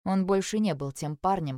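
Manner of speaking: ordinary delivery, nothing marked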